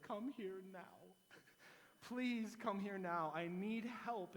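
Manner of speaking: slow, pleading plea for help; hammed-up desperate begging; begging the exterminator to come at once, mock panic.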